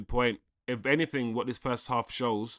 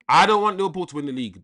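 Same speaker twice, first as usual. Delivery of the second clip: louder, higher and fast, blurting it out; emphatic outburst; 5 semitones higher, 9 dB louder, faster than usual. Real, not acted. Streamer insisting to chat he won't back Liverpool, loud fast outburst. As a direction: fire it off like a protest you mean.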